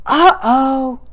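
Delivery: high, loud mock-surprised uh-oh to a baby; exclaim. Shown as a demonstration. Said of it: "you can exclaim".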